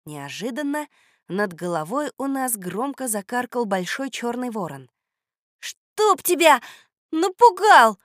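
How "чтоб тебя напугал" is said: high, loud startled yell; startled cry. A narrator's reading. Girl startled by a cawing raven, crying out loudly. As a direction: cry out sharply after a fright. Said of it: "крикнула Люська".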